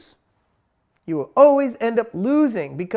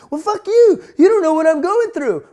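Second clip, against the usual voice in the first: much higher, loud, cursing blast; furious outburst; 8 semitones higher, 8 dB louder than usual. Retold or imitated. Homeless person yelling at a passerby who refused, furious shout. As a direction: explode at the stranger who said no.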